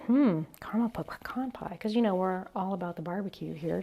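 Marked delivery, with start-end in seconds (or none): 0.1-1.8 whispering. Retold or imitated. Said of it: "and I thought".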